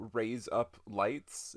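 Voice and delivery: "raise up lights" said slowly, each word apart; slow demo. Shown as a demonstration. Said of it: "try it yourself".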